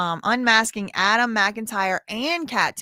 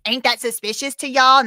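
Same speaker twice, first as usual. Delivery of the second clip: higher, louder mocking snark; mocking viewer's question; 4 semitones higher, 7 dB louder than usual. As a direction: parrot the sassy commenter. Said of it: "not do this".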